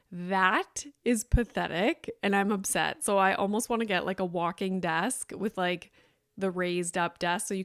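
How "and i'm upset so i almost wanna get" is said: ordinary delivery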